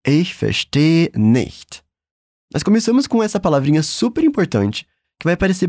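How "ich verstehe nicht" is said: Ich verstehe nicht drawn out slowly; very slow model phrase. Shown as a demonstration. Teacher modelling the phrase slowly. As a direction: lay out each word. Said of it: "Bora pra próxima parte".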